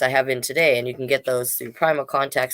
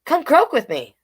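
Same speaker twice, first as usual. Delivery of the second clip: frog's invitation; 10 semitones higher, 5 dB louder than usual. Acted. Frog invites mouse.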